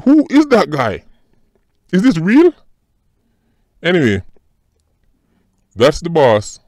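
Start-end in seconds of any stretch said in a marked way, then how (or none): 0.0-2.5 high-pitched, loud mock disbelief; incredulous. Vlogger retells reaction to the accent, incredulous. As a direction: act out being floored, voice shooting way up.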